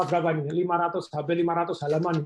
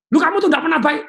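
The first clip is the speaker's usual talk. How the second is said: angry accusation; 10 semitones higher, 8 dB louder than usual. Retold or imitated. Role-played quarrel, angry spouse.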